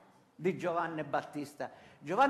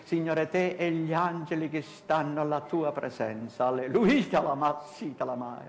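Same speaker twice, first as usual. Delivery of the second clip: solemn prayer with long, emphatic praises; fervent prayer; 4 semitones lower, 4 dB louder than usual. Acted. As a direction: pray out firmly, settling lower into the amens.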